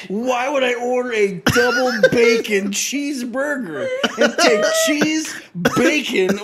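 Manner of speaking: high, loud, laughing indignation; exasperated protest. Real, not acted. Friend defending his burger order, exasperated and half laughing. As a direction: plead your case, stretching the words in disbelief.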